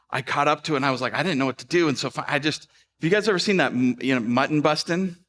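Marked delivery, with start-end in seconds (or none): none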